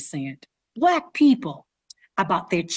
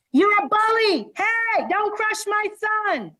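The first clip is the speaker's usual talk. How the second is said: retold yelling; 12 semitones higher than usual. Students retelling parents yelling from the stands, high mock yell. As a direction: shout it like an angry parent in the arena. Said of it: "they were yelling at me".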